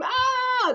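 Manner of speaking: a sharp high sigh of pleasure; pleased high exhale; high satisfied ah. Real, not acted. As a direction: let out a delighted ah. Savouring ice cream.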